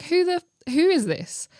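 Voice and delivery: mock suspicion. Retells suspicion of the message again.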